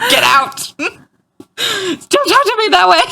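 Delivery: high, squeaky mock outrage, half laughing; a mom pretending to be offended by her kid; mock outraged mom. Mom retelling how she told off her daughter, high mock outrage. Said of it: "And I was like".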